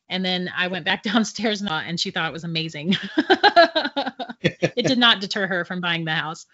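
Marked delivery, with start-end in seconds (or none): 3.0-4.7 high, loud laughter; real laugh. Real, not acted. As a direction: laugh out loud at your own story. Amused after telling friend's reaction.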